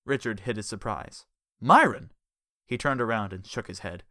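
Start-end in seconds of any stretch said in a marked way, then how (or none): 1.6-2.1 loud startled cry of a name; surprised shout. A narrator's reading. Richard spotting Jellyfish in his car, loud startled shout. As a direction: blurt the name out in shock.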